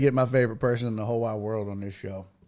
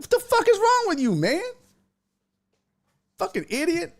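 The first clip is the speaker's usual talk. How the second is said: fast, very high-pitched scolding; retold angry demand, voice pitched way up; incredulous outburst. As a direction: squawk it in exasperated disbelief. Retells outburst at the joker.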